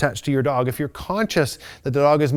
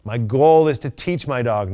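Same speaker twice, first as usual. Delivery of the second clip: ordinary talk, nothing marked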